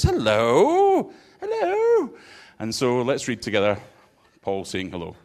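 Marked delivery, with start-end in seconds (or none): 0.0-1.1 a high, sing-song hello; cheery apostle greeting. Retold or imitated. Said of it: "He says". 1.4-2.1 a very high, squeaky second hello; even higher greeting. Retold or imitated. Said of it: "He says".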